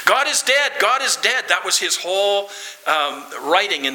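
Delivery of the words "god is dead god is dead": crying out. Retold or imitated. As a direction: cry it out like a man despairing. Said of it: "he cried out".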